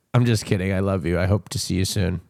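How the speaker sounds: low, level monotone reassurance; low deadpan. Real, not acted. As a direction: drop low and rattle off the sweet words evenly. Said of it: "I don't know why I said it like that".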